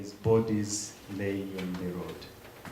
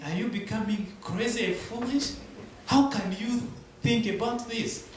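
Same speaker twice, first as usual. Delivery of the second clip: higher, urgent retelling of the crowd's alarm; lightly voiced crowd pleading in high disbelief; 6 semitones higher than usual. Townspeople urging the pastor not to return, higher alarmed disbelief.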